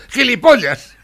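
put-on high, loud old woman yelling the insult; old lady's outburst. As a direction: blurt it out like a cranky old lady. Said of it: "No se le ocurrió nada y dijo".

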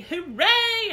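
high, loud hooray; happy cheer. A narrator's reading. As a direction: cheer with joy. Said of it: "danced all around the room real happy".

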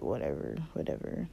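low, soft, brushing it off; lightly mocked dismissive cousin. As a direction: wave the kid away under your breath. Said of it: "he looking at me like".

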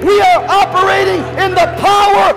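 high, loud preacher's climax; preaching at full force. Acted. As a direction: shout it out like a revival preacher. Preaching builds to a shout.

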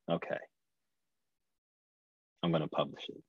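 soft, resigned okay, barely voiced; resigned hush. Deciding at last to publish her book, soft and resigned. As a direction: give in quietly, almost to herself.

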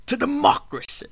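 breathy, forceful movie quote; hushed but intense, mid-duel on a lava planet; Obi-Wan Kenobi. Acted. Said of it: "this is when Obi-Wan and Anakin are fighting".

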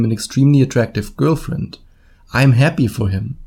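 usual speaking voice

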